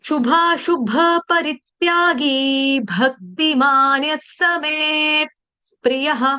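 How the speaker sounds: शुभाशुभ परित्यागी recited slowly and evenly; slow recitation demo. Shown as a demonstration. Teacher reciting a Gita verse for students, slowly. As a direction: recite the line slowly, syllable by syllable. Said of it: "ये जो शब्द है".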